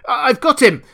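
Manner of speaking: very high, loud yell; frantic scream. A narrator's reading. Kemp grappling an unseen foe, frantic scream. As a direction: scream it out mid-struggle. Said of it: "screamed Kemp".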